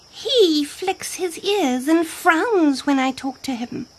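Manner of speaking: high, slow impala voice; impala character. A narrator's reading. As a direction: complain as a high-voiced little antelope. Impala complains in story.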